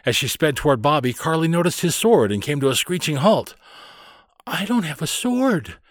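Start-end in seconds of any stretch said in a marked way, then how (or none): 4.5-5.8 put-on high, plaintive child's lament; sad little girl. A narrator's reading. Carley without a pirate sword, mildly sad. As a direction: think it sadly in a small girl's voice. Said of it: "she thought sadly".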